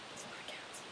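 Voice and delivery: soft breathy whisper; whispered aside. Real, not acted. Reader awed by the crowd of cats, whispering. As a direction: lean in and whisper it.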